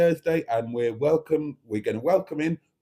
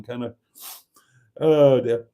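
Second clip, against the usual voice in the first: ordinary delivery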